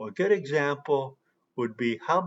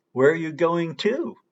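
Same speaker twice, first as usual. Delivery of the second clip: "to" said in its full strong form, raised and louder; strong "to" at end; 4 semitones higher, 4 dB louder than usual. Shown as a demonstration. Teacher gives students an emphasis example with strong "to". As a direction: land on "to" as the end of "Where are you going to". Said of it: "another example".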